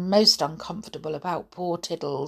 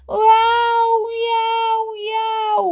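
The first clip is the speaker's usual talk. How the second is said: very high, long cat yowls; wailing yowls; 5 dB louder, much slower than usual. Acted. Kitten stranded up the chimney, wailing. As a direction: yowl three times like a stranded cat. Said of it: "wailed Tiddles up the chimney".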